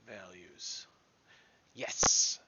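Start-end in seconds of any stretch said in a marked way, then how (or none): 1.7-2.4 excited yes. Real, not acted. Bug seems fixed, exclaims.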